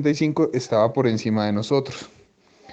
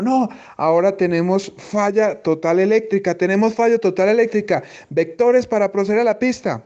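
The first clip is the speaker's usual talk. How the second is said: higher, tense mock radio call; urgent pilot; 7 semitones higher than usual. Pilot reporting failure to controller, urgent higher voice.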